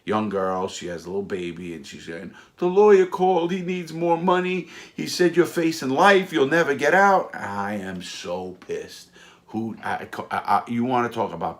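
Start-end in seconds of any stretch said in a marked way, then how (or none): 2.6-7.3 high-pitched, frantic imitation of his sobbing wife; hysterical crying wife. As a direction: mimic her panicked crying, pitched way up. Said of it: "she's hysterical crying".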